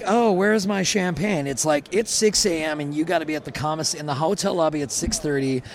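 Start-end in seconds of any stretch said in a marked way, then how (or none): 0.1-1.5 high, slow pampered whine; mock diva. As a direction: play the spoiled star asking for champagne. Mocking pampered food truck life.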